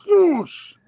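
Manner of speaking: loud, high whoosh sound effect; imitated whoosh. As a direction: punch out a comic-book whoosh. Imitating a comic-panel sound effect.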